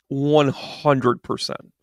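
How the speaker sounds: drawn out at half speed, each word weighted; slow emphatic repeat. Real, not acted. Confirming a point to the listeners, slow and emphatic. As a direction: stretch out "One hundred percent" deliberately.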